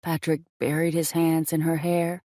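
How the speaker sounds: ordinary delivery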